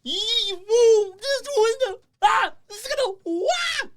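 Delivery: very high giddy squeals with laughter; excited squeal. Real, not acted. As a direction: let out high fanboy squeals. Squealing after the guest leaves.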